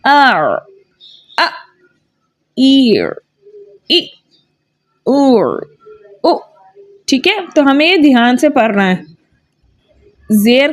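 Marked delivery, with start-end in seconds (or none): 0.1-6.4 आर अ ईर इ उर उ in pairs; vowel drill. Shown as a demonstration. Teacher drilling vowel pairs for students. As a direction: sound out each pair crisply. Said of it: "तो हम कैसे पढ़ेंगे".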